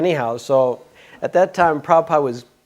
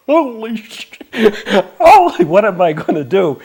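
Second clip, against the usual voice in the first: much higher, louder panicked exclamation; shocked outburst; 10 semitones higher, 9 dB louder than usual. Real, not acted. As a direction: blurt out in stunned alarm with a laugh. Shock at being told take sannyas now.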